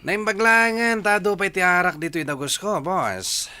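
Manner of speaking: loud, high, rowdy cheer; boisterous drinking buddy. Acted. Henchman drinking with his boss, boisterous. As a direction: crow about the liquor, loudly.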